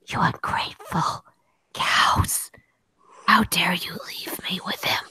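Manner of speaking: harsh, forceful stage whisper; hammed-up whispered tirade; whispered mock curse. Abandoned friend curses everyone for leaving, in a theatrical whisper.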